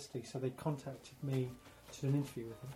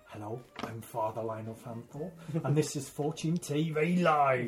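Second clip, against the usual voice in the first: Lionel Fanthorpe impression. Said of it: "I can do Father Line of Fanfare".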